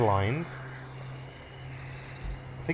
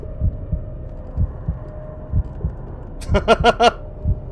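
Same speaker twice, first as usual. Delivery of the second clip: laughing; 8 semitones higher than usual. Real, not acted. Found dagger, laughs.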